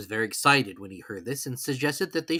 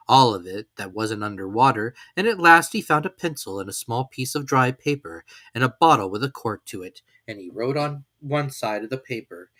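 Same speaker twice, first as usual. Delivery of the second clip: ordinary delivery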